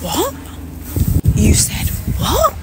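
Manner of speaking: slow, incredulous rising what; stunned disbelief. Real, not acted. Hearing about the egg remark, stunned and rising. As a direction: drag it out in shock, climbing up.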